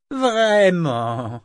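high, loud amused dragon; mock surprise from a dragon; teasing dragon. A narrator's reading. Dragon teases Rain.

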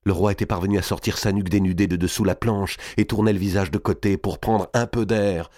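usual speaking voice

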